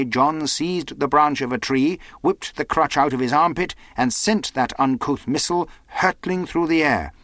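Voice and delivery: ordinary delivery, nothing marked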